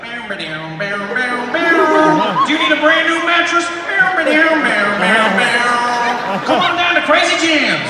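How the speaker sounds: high, loud hyped ad pitch; TV mattress salesman. Retold or imitated. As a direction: yell it like a cheesy TV ad. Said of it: "mattress salesman who comes out".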